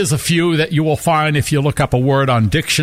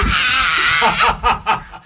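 laughing fit; 6 semitones higher than usual. Real, not acted. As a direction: crack up laughing uncontrollably. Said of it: "You wouldn't even get it out without laughing".

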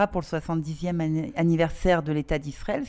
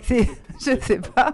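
amused, louder, through a laugh; 5 semitones higher, 6 dB louder than usual. Real, not acted. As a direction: say it while laughing, drawn out. Rire après le compliment. (en riant)

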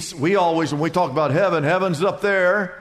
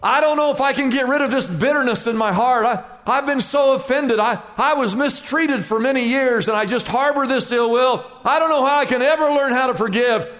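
higher, fast, anxious confession; troubled believer; 4 semitones higher, faster than usual. Retold or imitated. Believer confessing bitterness to the pastor, anxiously. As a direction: voice someone stuck in bitterness.

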